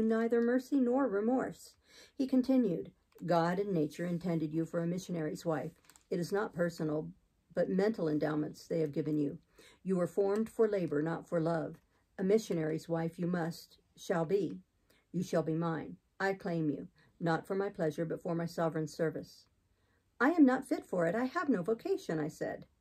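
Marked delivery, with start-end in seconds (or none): 3.2-19.5 low, measured, stern clergyman; deepened voice of an unyielding man; relentless missionary. Acted. Voices Saint John. 20.2-22.2 higher-pitched, earnest refusal; lifted voice of a reluctant heroine; protesting. Acted. Jane protests Saint John's claim.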